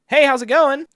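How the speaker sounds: high, loud, lightly voiced phone greeting; mechanic's greeting. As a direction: greet brightly as the mechanic, pitched well up. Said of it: "my mechanic called me back and was like".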